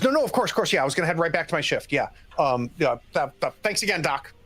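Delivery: flustered, rushed backpedalling; startled. Acted. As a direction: stumble hastily over your words, eager to get away. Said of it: "he is startled".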